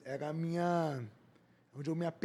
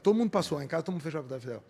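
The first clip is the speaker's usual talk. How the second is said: ordinary talk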